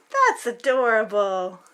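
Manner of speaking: plain reading voice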